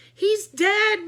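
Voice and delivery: high, loud wail; anguished cry. A narrator's reading. Author crying over her pet, high and loud. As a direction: cry out in grief. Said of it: "Miss Suki cried".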